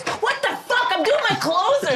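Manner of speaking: high, half-laughing imitation of a yell; a playful mimic of a furious comic; mock yell. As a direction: act out a comic's sudden outburst. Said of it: "and starts yelling".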